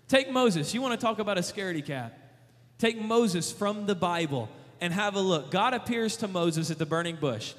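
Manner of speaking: usual speaking voice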